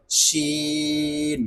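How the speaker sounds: শিন with a very long held vowel; drawn-out sh in শিন, mid-tongue to palate; demonstrating articulation. Shown as a demonstration. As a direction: stretch শিন slowly on a level note. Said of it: "উপরে তালুর সঙ্গে লেগে যাবে".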